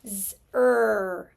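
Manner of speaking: Z-ER split into a buzzed Z and a held "er"; sounding out. Shown as a demonstration. Teacher segmenting the last syllable, sound by sound. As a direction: stretch Z-ER into two sounds. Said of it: "sounds like a Z".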